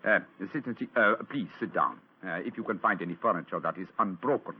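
put-on Holmes passing as the German count, a light German accent; lightly voiced German count; German count disguise. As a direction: keep up the German accent to fool his friends. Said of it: "I hope they won't recognize me".

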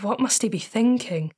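ordinary delivery, nothing marked

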